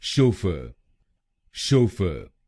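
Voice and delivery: Chauffeur with शो punched and fer light; stress on SHO. Shown as a demonstration. Teacher demonstrates stress on the first syllable. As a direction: hit शो, drop fer. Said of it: "पहले शब्दांश पर ... जोर डालेंगे".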